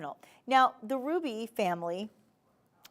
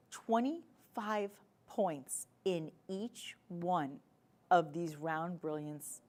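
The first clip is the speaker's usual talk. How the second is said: slow emphasis; 2 semitones lower, 5 dB quieter, much slower than usual. Real, not acted. Host stresses stone size.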